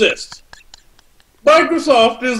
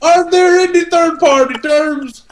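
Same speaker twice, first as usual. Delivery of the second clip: mock dramatic shout; 5 semitones higher than usual. Dramatic shouted section heading.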